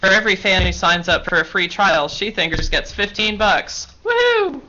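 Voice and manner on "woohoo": high excited woohoo; excited cheer. Real, not acted. Cheering about fifteen bucks.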